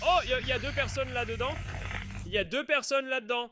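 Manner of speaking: sudden high alarmed exclamation; surprised. Real, not acted. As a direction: gasp out the discovery, high. Spotting two people in burning car.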